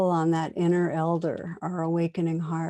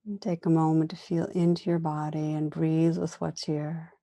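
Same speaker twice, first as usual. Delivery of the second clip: calm meditation guide, long pauses between phrases; guided meditation. Real, not acted. Teacher inviting listeners to turn attention inward, guided meditation. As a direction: gently lead listeners inward, unhurried, leaving room after each phrase.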